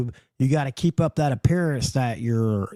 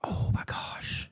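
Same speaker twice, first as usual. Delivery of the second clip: mock whisper; 6 semitones higher, 9 dB quieter, slower than usual. Mock gasp at recalled fact.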